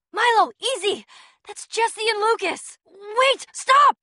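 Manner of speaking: shouted, pitch rising to a high stop; urgent shout. Acted. Shouting at Milo to stop attacking her friends. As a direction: yell to halt him before he strikes.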